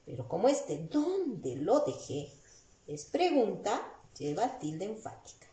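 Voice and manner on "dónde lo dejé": ordinary delivery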